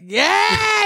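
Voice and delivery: high-pitched, drawn-out yell of yeah; mock excited cheer. Teasing a friend's awkward thanks, mock excited cheer.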